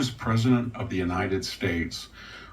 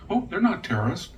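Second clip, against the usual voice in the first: mock dismissive; 10 semitones higher than usual. Mocking Biden's dismissive line.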